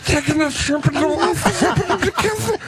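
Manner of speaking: high, giggling delivery; can barely get it out for laughing; cracking up; laughing hysterically. Real, not acted. Laughing hysterically about shrimp.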